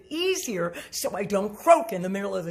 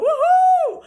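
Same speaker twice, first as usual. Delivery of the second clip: loud, high, drawn-out woohoo; excited whoop; 11 dB louder than usual. Real, not acted. Coach cheering on singers' strong muscles, excited whoop. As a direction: let out a big celebratory cheer.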